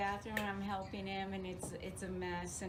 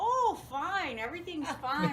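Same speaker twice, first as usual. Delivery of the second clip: cheerful; 7 semitones higher, 5 dB louder than usual. Retold or imitated. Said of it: "And he's like".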